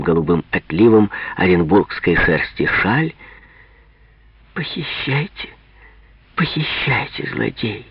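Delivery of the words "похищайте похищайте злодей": soft laughing. Acted. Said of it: "говорит она и тихо смеётся".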